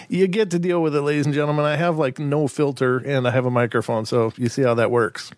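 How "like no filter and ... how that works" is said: ordinary talk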